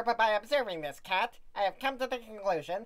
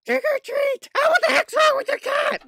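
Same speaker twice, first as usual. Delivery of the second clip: much higher, much louder monster cry; shrill shout; 13 dB louder than usual. Acted. Mister Monster trick-or-treating, loud high shout. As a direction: yell it at the door.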